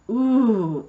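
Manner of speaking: a loud impressed ooh; class going ooh. Retold or imitated. Class reacting to a huge number, loud impressed ooh. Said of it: "The class made a bunch of noise".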